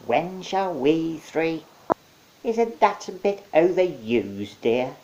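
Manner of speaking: high, slow, loud crone voice; tiny witch scolding her sister. A narrator's reading. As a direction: play a bickering old witch. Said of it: "three tiny witches argue".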